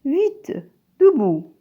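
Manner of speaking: high, loud urgent command; urgent order. Shown as a demonstration. Teacher demonstrating an urgent order to the class. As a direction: snap Vite, debout like waking someone late. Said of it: "C'est un ordre".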